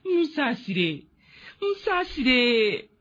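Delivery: drawn-out desperate begging; slow pleading. Acted. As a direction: plead slowly for mercy. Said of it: "We beg the torturers".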